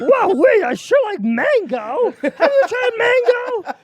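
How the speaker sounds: high, loud, strained rasp; hammed-up cashier raving about food; squawking cashier. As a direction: crow about mango and Jollibee. Cashier voice continued.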